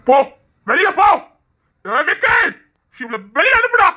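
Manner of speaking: enraged man orders her thrown out; angry order. Acted.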